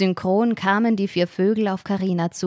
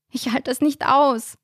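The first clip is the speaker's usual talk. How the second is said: panicked cry; 7 semitones higher than usual. Acted. Terrified outburst during bird attack.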